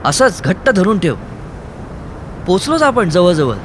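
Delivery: breathless urging; urging shout. Acted. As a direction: shout to keep her holding on. Urging her while shouting.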